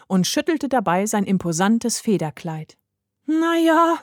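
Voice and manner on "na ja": put-on high, hesitant drawn-out child's voice; little girl explaining. A narrator's reading. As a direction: pitch it up like a small girl. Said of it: "begann die Kleine einen Erklärungsversuch".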